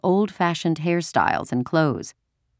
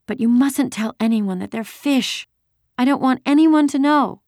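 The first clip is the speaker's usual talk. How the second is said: put-on high, insistent child's voice; childish plea for secrecy, pitched up; little girl; 10 semitones higher, 3 dB louder than usual. A narrator's reading. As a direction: warn them like an earnest small child. Small girl demands secrecy.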